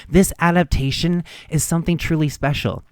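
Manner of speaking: ordinary talk, nothing marked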